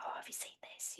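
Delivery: hushed, secretive whisper. Retold or imitated. Schoolkids passing round the Janet photo, whispering. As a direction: breathe it like a shared secret. Kids sharing the spooky photo.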